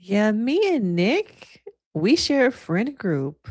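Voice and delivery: [name]. Acted. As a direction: play a reality-TV confrontation, stretching phrase endings. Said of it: "the Kim K voice".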